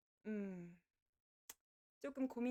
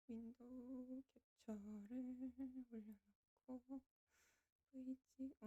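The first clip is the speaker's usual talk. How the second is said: muttering to self; 9 dB quieter, slower than usual. Real, not acted. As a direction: quietly think aloud, absorbed. Muttering while setting up capture. (작게 중얼거리며 혼잣말로)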